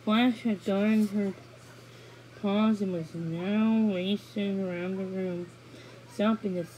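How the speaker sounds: usual speaking voice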